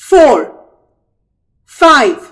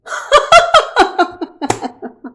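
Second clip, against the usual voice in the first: gleeful trickster laugh; high mocking giggle; 11 semitones higher than usual. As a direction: laugh at the fooled alligators. Rabbit laughs.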